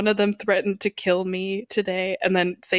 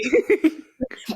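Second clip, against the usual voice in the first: high burst of laughter; 9 semitones higher than usual. Real, not acted. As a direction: crack up at the nickname. Laughing at Perky joke.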